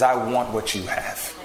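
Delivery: low, slow, understated plea; subdued imitation of a moved man; retold tearful plea. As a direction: quote a weeping man softly pleading, without sobbing. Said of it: "he's crying. And he says".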